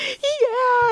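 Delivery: high, drawn-out imitation of excitement; mock enthusiastic reply. Acts out the others' reply.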